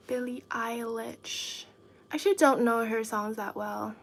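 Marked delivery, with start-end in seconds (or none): 0.1-1.6 whispered name. Shown as a demonstration. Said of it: "repeats name softly".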